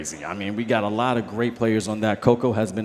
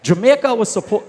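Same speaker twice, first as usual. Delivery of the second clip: Jamaica said with a slipped-in Jamaican accent; the accent sneaks out on Jamaica; 9 semitones higher, 6 dB louder than usual. Real, not acted. Cohost asks about Jamaica, he answers in a Jamaican accent. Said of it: "I don't know why I got the accent".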